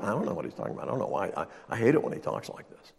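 mock disciples grumbling softly. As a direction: grumble low and fed up. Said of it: "they're like".

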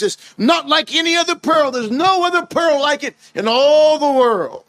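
rising preaching. Acted. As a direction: lift it into a ringing proclamation. Preacher rises to climax.

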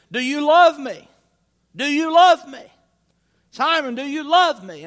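loud, high, insistent asking; loud pleading question. Acted. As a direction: press the question hard and loud. Jesus questions Peter, preacher voicing.

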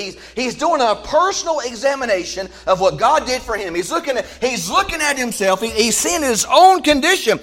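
loud, high, rising sermon cadence; preaching at full force. Acted. Preacher driving the verse home to the congregation, loud and rising. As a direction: preach it out, building to a shout.

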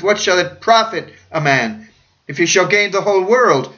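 booming pulpit quotation; hammy stage actor. Acted. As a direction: declaim it grandly. Preaching at full force.

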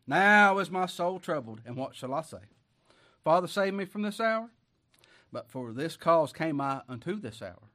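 loud, ringing pulpit reading; preaching at full force. Acted. Preacher reading Jesus' words to the church, loud. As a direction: proclaim the verses like thunder.

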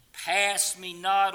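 slow, high cry of Pass me not; loud plea. Acted. Preacher crying out a hymn plea.

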